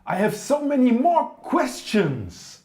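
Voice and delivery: loud, high, desperate cry; desperate shout. Acted. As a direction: yell after him in panic. Begs emperor not to leave.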